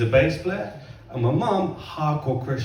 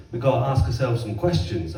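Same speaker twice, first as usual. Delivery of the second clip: ordinary talk, nothing marked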